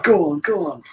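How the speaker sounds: high, mock cheering from the stands; mild crowd-style encouragement; imitated crowd cheer. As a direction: egg the player on like a fan. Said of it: "everyone was cheering".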